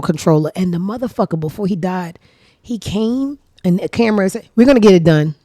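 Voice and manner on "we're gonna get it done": loud, cocky declaration. Retold or imitated. Man insisting to the cameras, cocky and loud. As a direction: announce it with full bravado. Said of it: "such a cocky".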